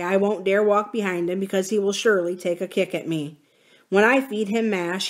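ordinary delivery, nothing marked

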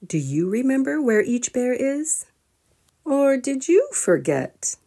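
usual speaking voice

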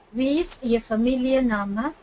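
ordinary delivery

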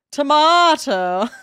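slow, high British Tomato., said tomahto, rising; drawn-out British Tomato; slow British accent. Acted. Streamer hamming the British accent again. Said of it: "My perfect British accent".

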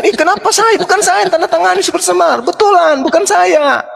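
high, whining schoolboy pleading; mock crying plea. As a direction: beg like a boy near tears, played for laughs. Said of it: "sambil dirangis kan". (rengekan anak pura-pura menangis, suara tinggi)

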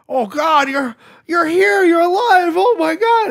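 high, loud hammed-up amazement; mock overjoyed. Imitating the hero hugging a stranger, hammed-up joy. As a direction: gush it, voice leaping up in disbelief.

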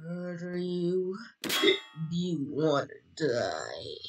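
deep monster voice. Acted. Slow low menacing voice.

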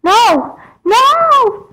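very high screaming no; terrified scream. Acted. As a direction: scream in panic as you fall. Being pushed into water.

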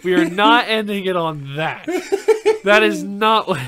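loud laughing objection; laughing protest. Real, not acted. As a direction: laugh while refusing.

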